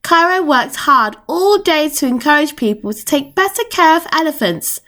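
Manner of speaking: ordinary delivery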